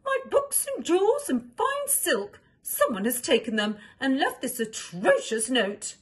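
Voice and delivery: very high, loud mock wail; hammed-up distraught queen. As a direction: wail over the stolen treasures. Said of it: "she cried".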